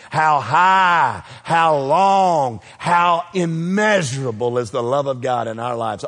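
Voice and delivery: ordinary delivery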